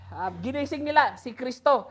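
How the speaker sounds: usual speaking voice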